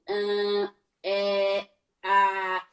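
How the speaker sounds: long level "ah... é... ah" hesitations; drawn-out filler sounds. Shown as a demonstration. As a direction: drone each filler on one note. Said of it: "substituir as pausas pelo". (hesitações arrastadas, monótonas)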